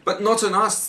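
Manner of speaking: acted storybook outcry; crying out. A narrator's reading. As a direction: protest as the alarmed little oysters. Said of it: "the oysters cried".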